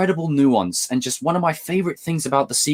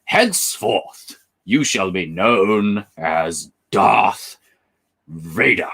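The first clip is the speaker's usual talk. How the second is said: slow, drawn-out sinister emperor; Palpatine quote; 6 semitones lower, much slower than usual. Retold or imitated. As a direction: intone it slowly like a dark lord. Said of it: "watching Anakin go to the dark side".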